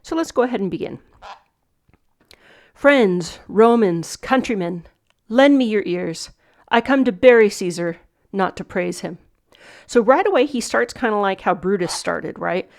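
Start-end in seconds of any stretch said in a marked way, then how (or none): 2.7-9.2 loud, declaimed opening of a funeral oration; Shakespeare recitation. Shown as a demonstration. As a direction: proclaim it to the crowd like Mark Antony. Teacher recites Antony's speech.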